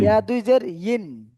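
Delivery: slow drill, ইন drawn out as "yin"; ইন with initial y. Shown as a demonstration. Teacher corrects student's "win", ইন said slowly as "yin". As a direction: glide from y into a long ইন. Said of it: "আমরা উচ্চারণটা করব".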